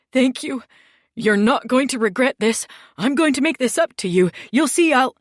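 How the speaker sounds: high, loud, rushing thanks; gushing relieved thanks. Acted. Spared man gushes thanks to the boss, high and loud.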